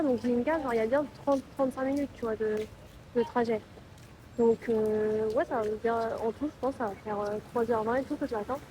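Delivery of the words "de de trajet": normal talking voice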